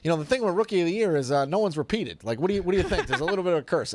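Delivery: high, quick, sly needling voice; Norm Macdonald. Retold or imitated. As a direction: tease him about the curse in a high, quick voice. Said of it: "the way he talks to him".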